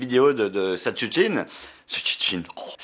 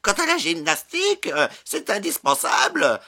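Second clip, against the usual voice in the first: lightly voiced old lady; 6 semitones higher than usual. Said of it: "elle répond".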